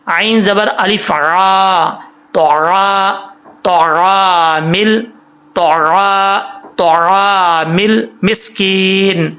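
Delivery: syllables drawn out, تو heavy; slow recitation drill. Shown as a demonstration. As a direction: build تو را مل مسکین slowly. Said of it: "اب پڑھنا کیسے ہے؟".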